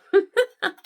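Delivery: high, loud burst of laughter at the gift; loud delighted laugh. Real, not acted. Thrilled by a gift from Jean, a loud high laugh.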